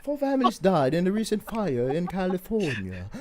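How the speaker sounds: mock British newsreader; posh British accent. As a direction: read grim news in a plummy put-on British accent. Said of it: "ang ganda ng balita pag British".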